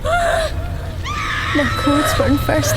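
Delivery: very high, slow agonized cry; scream of pain. Acted. Breda burning herself to break free, agonized scream. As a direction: scream as the fire takes you.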